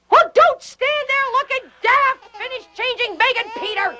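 angry scolding. Acted. Mistress scolds Anne.

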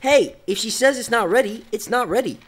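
loud, high, forceful defence; angry intervention. Acted. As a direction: shut them down on her behalf. Defends her in a quarrel.

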